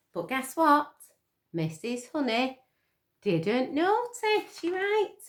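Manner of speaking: slow, emphatic didn't notice; drawn-out teasing punchline; playful reveal. Real, not acted.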